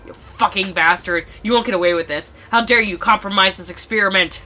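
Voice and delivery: loud, angry villain's yelling; furious Hongo. Acted. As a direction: yell up at someone escaping, outraged. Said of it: "furious Hongo yelling at me".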